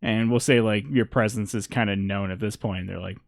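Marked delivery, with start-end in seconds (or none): none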